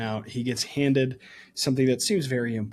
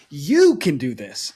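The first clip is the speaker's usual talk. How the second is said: encouraging; 2 semitones higher, 7 dB louder, slower than usual. Acted. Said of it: "he's gonna go".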